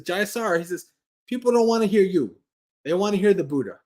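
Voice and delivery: put-on quoting the teacher in a New York accent; playful Brooklyn-style delivery of the quote. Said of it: "a New York accent like I just did".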